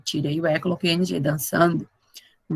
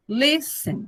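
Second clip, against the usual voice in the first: listen starting strong, then stepping down; "listen" slowly, weight first, descending at the end; falling stress; 5 semitones higher, 5 dB quieter than usual. Shown as a demonstration. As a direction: let "listen" fall away after the first syllable. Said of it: "Vai descendo".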